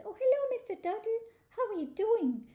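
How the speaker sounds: high-pitched, bubbly squirrel hello; cheery greeting. Acted. Squirrel meets Mister Turtle in the forest, cheery greeting. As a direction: greet a friend in a high sing-song voice.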